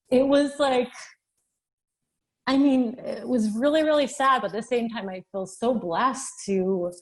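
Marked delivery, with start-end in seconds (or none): 0.1-4.4 emotional, near tears. Real, not acted. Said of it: "getting really emotional".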